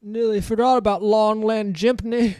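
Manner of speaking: high, loud Big Mama character voice. Acted.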